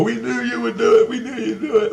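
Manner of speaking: high-pitched, excited mock chorus; imitated cheering crowd. Retelling the crowd after he refused to fight, high imitation. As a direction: voice the crowd in a high celebratory squeal. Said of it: "And they go".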